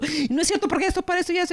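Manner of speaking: high, rushed mock interruption; interrupter. Patient cutting off the other speaker, high hurried interruption. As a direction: cut in fast and high, talking over them.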